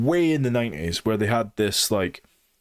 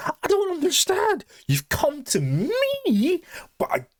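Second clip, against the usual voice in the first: mock Nori voice. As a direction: squeak it out like a bewildered little girl. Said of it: "but she's like".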